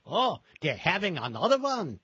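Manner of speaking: put-on playful Yiddish accent. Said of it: "in his best Yiddish accent".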